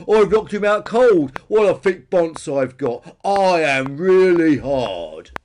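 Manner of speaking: loud, slow, drawn-out boasting; triumphant cry. A narrator's reading. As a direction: stretch out the boast at full volume. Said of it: "cried Peter".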